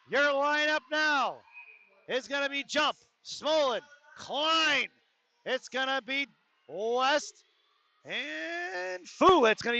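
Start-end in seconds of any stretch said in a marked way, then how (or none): 0.1-4.9 slow, drawn-out PA announcer call; arena announcer call. Real, not acted. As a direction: stretch each word like an arena announcement. Announcing the new line on ice.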